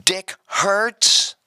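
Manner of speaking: high-pitched, slow roll call; teacher's drawn-out, questioning name call; calling out at full volume. Retold or imitated. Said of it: "in full volume".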